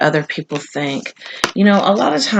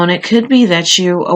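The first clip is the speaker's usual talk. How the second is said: ordinary talk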